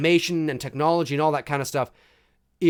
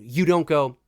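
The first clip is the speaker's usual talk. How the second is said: ordinary delivery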